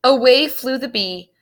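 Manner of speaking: usual speaking voice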